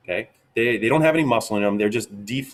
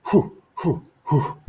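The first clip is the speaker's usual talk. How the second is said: three winded, breathy whew sounds, higher than his own voice; winded whew puffs; 6 semitones higher, slower than usual. Real, not acted. Host catching his breath after running around making videos, puffing out whews. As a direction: puff out air like he just ran around. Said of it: "I'm out of breath".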